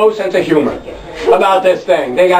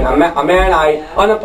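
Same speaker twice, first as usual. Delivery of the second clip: ordinary talk, nothing marked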